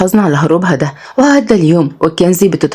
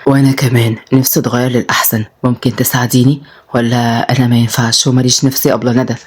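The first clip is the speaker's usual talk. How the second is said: tender, lowered appeal; 7 semitones lower than usual. A narrator's reading. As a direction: gently coax her with affection. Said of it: "قال كلامه بحنان".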